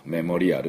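メモリアル in even katakana syllables, "me-mo-ri-a-ru"; メモリアル with Japanese vowels after every consonant; Japanese-style pronunciation. Shown as a demonstration. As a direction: sound out "a-ru" fully at the end of メモリアル. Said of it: "もう全然通じない".

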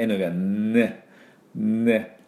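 ね with a long held "nnn" before the vowel; ゆっくり粘るN. Shown as a demonstration. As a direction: stretch the N of ね slowly. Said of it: "ゆっくりやるとね、". (ね の前に「ンー」と粘らせて)

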